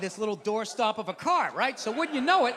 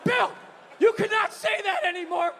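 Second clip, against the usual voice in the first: high, sharp imitated scolding; wife flipping out; 8 semitones higher than usual. Wife to husband over a banned word, imitated flipping out. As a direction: lay down the law, appalled. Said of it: "flips out".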